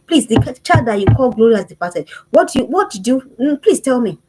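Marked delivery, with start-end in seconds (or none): 0.1-2.1 louder, pleading protest; indignant appeal. Real, not acted. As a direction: plead against the name loudly. Preacher protesting the name.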